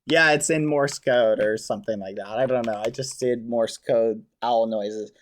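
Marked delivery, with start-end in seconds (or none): none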